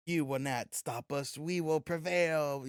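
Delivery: slow, drawn-out mock proclamation; generic heroic speech. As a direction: parody a grand hero's rallying speech. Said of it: "generic ass speech".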